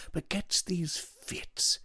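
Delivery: whispered aside. A narrator's reading. Devine confiding aside.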